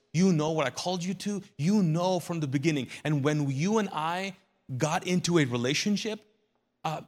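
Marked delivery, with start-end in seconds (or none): none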